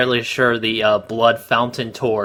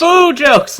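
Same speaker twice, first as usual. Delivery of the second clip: much higher, louder exclaim; mocking outburst; 14 semitones higher, 6 dB louder than usual. Real, not acted. Co-host mocks the food puns, much higher and louder. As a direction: burst out in exasperated glee.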